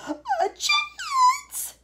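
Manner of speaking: very high, loud, drawn-out screech; yelling. A narrator's reading. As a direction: screech it in outraged disbelief. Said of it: "yelled Missus Salt".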